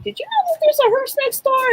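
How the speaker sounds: high-pitched, incredulous retelling of the question; surprised question. Retold or imitated. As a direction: squeak it out in disbelief. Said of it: "I told my daughter and I'm like".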